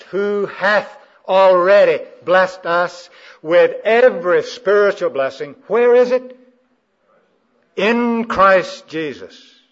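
building to a loud, high, slow preacher's cry; forceful scripture proclamation. Real, not acted. Preacher reading the verse to the congregation, forceful proclamation. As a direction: declaim the verse, swelling phrase by phrase to full force.

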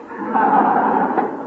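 loud high hearty fake laugh; big forced laugh. Shown as a demonstration. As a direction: lead the room in laughing.

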